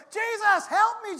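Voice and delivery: high, loud desperate shout. Acted. Preacher urging the congregation to cry out, shouting. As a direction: cry out with everything. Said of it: "you're gonna say".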